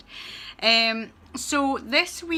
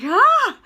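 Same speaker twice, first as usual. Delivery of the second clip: high, loud squeal of excitement; excited squeal; 14 semitones higher, 11 dB louder than usual. Retold or imitated. Student at the end of university, squealing with excitement. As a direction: let out a sudden thrilled shriek. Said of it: "I'm like".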